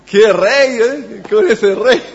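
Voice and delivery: loud, high remark broken by laughter; amused laughing. Real, not acted. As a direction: laugh in disbelief at such a king. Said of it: "me da risa a mí". (riéndose, divertido y en voz alta)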